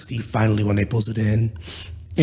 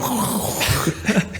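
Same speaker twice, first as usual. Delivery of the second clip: high, wordless grinding noise; imitated grinding noise; 11 semitones higher than usual. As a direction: mimic a rock grinding in a wheel. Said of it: "rock in there going".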